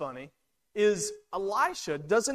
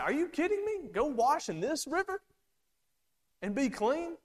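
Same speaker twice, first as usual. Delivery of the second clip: high, incredulous outburst at the absurd order; indignant disbelief; 8 semitones higher than usual. Retold or imitated. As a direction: voice Naaman's offended shock, pitched up. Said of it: "He got angry".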